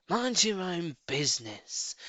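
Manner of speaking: mild mock shriek; shrieking rat. A narrator's reading. Drunk rat snapping at Mr Fox. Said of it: "shrieked Rat".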